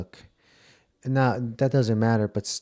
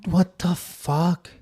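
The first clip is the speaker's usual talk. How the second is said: shocked; 7 semitones higher, much slower than usual. Real, not acted. Shocked reaction to reveal.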